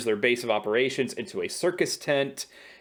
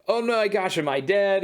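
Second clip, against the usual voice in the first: mock freak-out, pitched well up; high, panicky imitation; Agnes; 8 semitones higher than usual. Agnes freaking out at Vision, mock panic. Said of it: "Agnes starts freaking out, saying".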